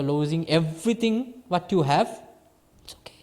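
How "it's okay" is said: a soft breathy whisper of comfort; whispered reassurance. Real, not acted. Teacher whispers comfort to a listener facing loss, hushed. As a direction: whisper it gently, like calming someone. Soothing aside to grieving listener.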